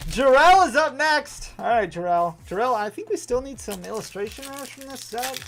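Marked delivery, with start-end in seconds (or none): 0.1-1.5 slow, loud, higher call; announcer call. Real, not acted. Host announcing the next viewer on stream, drawn-out call.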